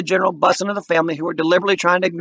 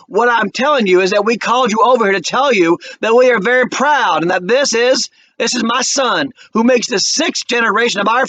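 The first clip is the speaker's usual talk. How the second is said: raised, slowed, near-breaking voice; tearful Walter; 8 semitones higher, 4 dB louder than usual. A narrator's reading. Walter refusing the offer, on the edge of tears. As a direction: push through the tears with pride. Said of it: "Walter started to cry".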